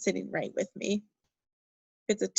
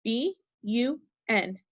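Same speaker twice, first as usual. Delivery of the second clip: letters B U N spelled slowly one by one; teacher spelling letter by letter, slow; slower than usual. Shown as a demonstration. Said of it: "Spell it".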